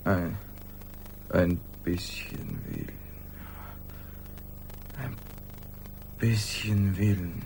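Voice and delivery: tief, langsam, wie aus weiter Ferne; verfremdete tiefe Traumstimme. Acted. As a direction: ein dumpfer Albtraum. (tiefe, verzerrte Traumstimme aus der Ferne)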